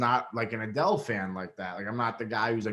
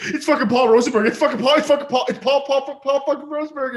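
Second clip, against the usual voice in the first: much higher, louder, fast fangirl squeal; starstruck fangirl; 9 dB louder, faster than usual. Retold or imitated. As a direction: shriek it like a ten-year-old girl seeing her idol. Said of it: "I became, like, a ten-year-old girl".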